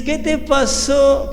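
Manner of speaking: mock-fuss like an overanxious parent; coddling parent. Parent running to a fallen toddler, mock coddling. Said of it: "le dices".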